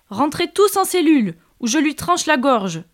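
cri haut et fort, menaçant; hurlement menaçant. Acted. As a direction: menace en hurlant. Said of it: "hurle-t-il". (le seigneur hurle sa menace aux captifs)

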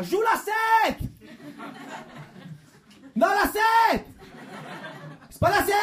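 shouted fan request, high; crowd shouting. Retold or imitated. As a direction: yell for your favourite song. Fans shouting at concert. (fan qui hurle depuis la foule)

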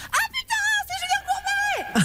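high squeal of recognition, laughing; shocked exclamation. Retold or imitated. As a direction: blurt it out in laughing disbelief. Retold shocked exclamation on phone.

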